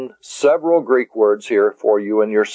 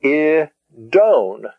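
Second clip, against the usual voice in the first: "I, don" in two parts, higher; syllable by syllable; 4 semitones higher than usual. Shown as a demonstration. Teacher dividing "I, don" to help learners, syllable by syllable. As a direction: say "I", pause, then a long "don". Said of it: "pronounce and divide".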